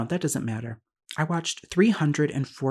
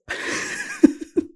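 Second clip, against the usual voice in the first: sudden airy giggle, much higher; high breathy laugh; 4 dB louder than usual. Real, not acted. Laughing at himself over doing the math, an airy laugh. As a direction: let out a light unvoiced laugh.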